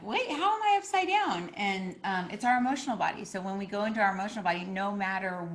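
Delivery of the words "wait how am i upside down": high, puzzled mock alarm; suddenly baffled, voice jumping up; confused surprise. Ego realizing it's upside down, puzzled. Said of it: "me going, like".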